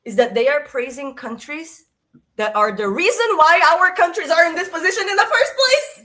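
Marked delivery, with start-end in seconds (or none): 2.3-6.0 loud, high-pitched indignation; real mad outburst. Real, not acted. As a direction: burst out indignantly, louder and higher. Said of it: "makes me the most mad".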